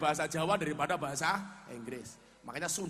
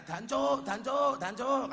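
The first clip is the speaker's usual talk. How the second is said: high, drawn-out mock cheer; imitated street yelling; 7 semitones higher, slower than usual. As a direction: call it out like excited Surabaya villagers. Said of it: "orang Surabaya teriak-teriak". (teriakan tiruan orang Surabaya)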